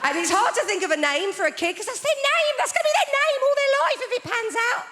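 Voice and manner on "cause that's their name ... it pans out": very high, strained comic worry; mock panic. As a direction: squeal it in exaggerated dread. Mock panic over naming a baby.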